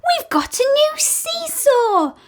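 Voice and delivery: put-on high, thrilled child voices; children thrilled by the new seesaw; excited children. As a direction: burst with glee.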